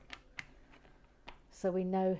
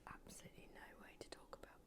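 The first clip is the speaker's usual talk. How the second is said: offended whisper; 5 semitones lower, 22 dB quieter, faster than usual. Acted. Mock offended aside.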